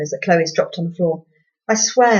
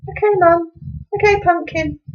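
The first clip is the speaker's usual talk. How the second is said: put-on much higher sing-song baby talk; 11 semitones higher, 3 dB louder than usual. A narrator's reading. Mum to her little girl Chloe, much higher cooing baby talk. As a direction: coo at her like a toddler. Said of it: "Ugh, baby talk".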